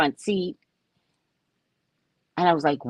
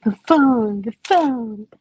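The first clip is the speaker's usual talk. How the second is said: mocking his whiny phone lament; hammed-up overdramatic complaint, higher; playing up his drama about the phone; dramatic whining man; 7 semitones higher than usual. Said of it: "You're so dramatic".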